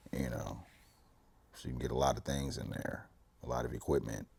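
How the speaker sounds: sly hushed aside. Real, not acted. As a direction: drop to a near whisper.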